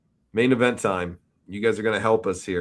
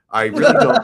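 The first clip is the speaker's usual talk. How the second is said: laughing; 13 semitones higher, 7 dB louder than usual. Real, not acted. Breaks up mid-impression.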